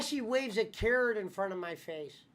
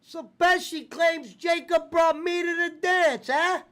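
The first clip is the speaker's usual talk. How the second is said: mock high voice; 12 semitones higher, 8 dB louder than usual. Mocking the claim.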